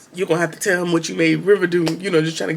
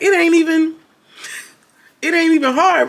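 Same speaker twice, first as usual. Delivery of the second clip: much higher, scornful squeal of disbelief; mocking high voice; 10 semitones higher, 4 dB louder than usual. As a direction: sneer it up high like she can't believe it. Mother mocks the young man.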